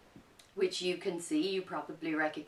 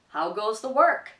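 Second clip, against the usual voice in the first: loud calling out from bed; farmer calling; 4 semitones higher, 10 dB louder than usual. Acted. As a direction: shout the question from bed. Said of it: "called the farmer".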